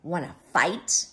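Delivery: rising, pushy challenge; lightly voiced storybook bully; grouchy ladybug. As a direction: dare them to a scrap. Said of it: "said the grouchy ladybug".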